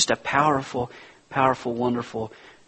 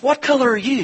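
challenging question; 13 semitones higher, 5 dB louder, faster than usual. Retold or imitated. Driver turns to other side.

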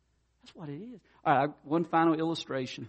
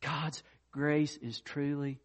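slowed, softer, tender conclusion; sincere closing; 6 dB quieter, slower than usual. Real, not acted. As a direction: land the final truth gently. Sermon closing statement.

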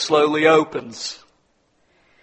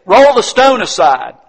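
loud, slow, raised order; acted command; 6 semitones higher, 12 dB louder, slower than usual. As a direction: command it with full authority. Preacher voices Jesus commanding.

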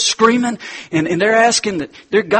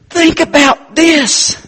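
mock teacher; 12 semitones higher, 6 dB louder, much slower than usual. Atheist teacher pressing the girl.

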